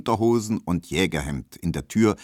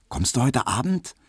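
ordinary delivery

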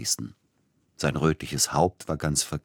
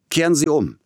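much higher, loud yell to stop; shouting; 11 semitones higher, 7 dB louder than usual. Acted. Passenger shouting at the coachman to turn back, loud. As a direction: bark the order out. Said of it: "schrie er dem Kutscher zu".

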